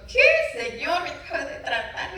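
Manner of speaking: sudden high, loud "Qué" of disbelief that settles into a high protest; shocked disbelief. Retold or imitated. Retelling her stunned reply when God told her to feed the woman who mistreated her, high and incredulous. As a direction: gasp it out as if you can't believe the order.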